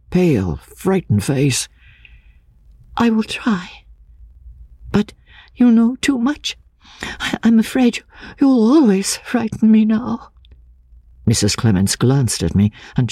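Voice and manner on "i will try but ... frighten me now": high, hushed voice starting in a whisper; fearful young woman. A narrator's reading. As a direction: afraid but forgiving. Anne, still afraid of him.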